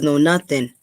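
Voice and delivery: normal talking voice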